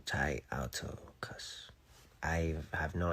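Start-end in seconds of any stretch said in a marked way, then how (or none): 0.0-1.7 whisper. Real, not acted. Whispering a foreign phrase.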